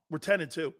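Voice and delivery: usual speaking voice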